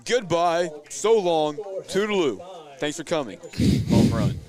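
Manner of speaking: loud, high announcer home run call. Shown as a demonstration. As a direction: belt it out like a stadium announcer. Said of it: "I would like to do my home run call one time".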